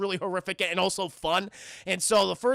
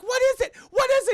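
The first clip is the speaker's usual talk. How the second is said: very high, loud frantic question; mock panicked shriek; 5 dB louder than usual. Spouse to husband fleeing back into the living room, mock panicked shriek. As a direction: shriek it in hammed-up alarm.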